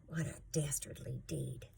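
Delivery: whispered aside. A narrator's reading. Narrator aside on the trip.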